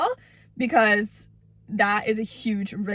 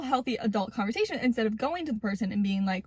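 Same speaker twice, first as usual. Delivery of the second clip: ordinary talk, nothing marked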